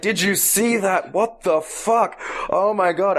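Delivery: a hint of breathless astonishment; mock shocked gossip. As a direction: replay the carriage's stunned disbelief. Passengers' excited chatter, retold.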